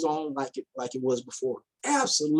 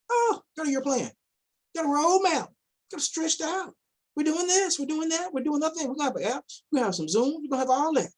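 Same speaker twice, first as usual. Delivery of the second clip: higher mock salesman patter, lightly voiced; mocking the promiser; 8 semitones higher than usual. Mocking the man who promised a year plan, high sarcastic parody. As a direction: parody a smooth talker's empty promises.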